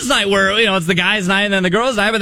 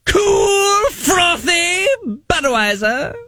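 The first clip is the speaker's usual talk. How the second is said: high, bellowing, pompous herald; angry town crier voice; 9 semitones higher, slower than usual. Acted. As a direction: roar the order like a furious crier. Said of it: "in the town crier voice".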